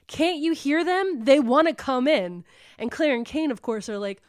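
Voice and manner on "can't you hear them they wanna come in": raised voice, louder and higher, imitating a frantic man; retold scream. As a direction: act out screaming at someone, pleading. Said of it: "Voller's screaming".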